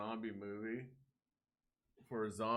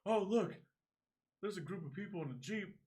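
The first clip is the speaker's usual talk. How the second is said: put-on naive kid; 12 semitones higher, 4 dB louder than usual. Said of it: "He's like".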